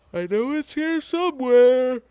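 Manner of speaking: high, loud odd voice; shouted character line. Acted. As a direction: call it out in a high put-on voice. NPC searching maps.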